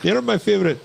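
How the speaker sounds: put-on high-pitched Indian accent; mock call-center Indian accent. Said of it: "in an Indian accent".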